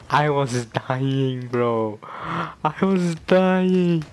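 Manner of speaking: very slow, drawn-out exclamation; overcome with amusement. Real, not acted. Fan recalling a hilarious scene, long drawn-out delighted wail. Recalling how hard they laughed.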